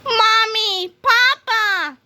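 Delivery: put-on very high, loud little-kid voice; a kid running to his parents; childish cry. A narrator's reading. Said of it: "cried Mister Krupp".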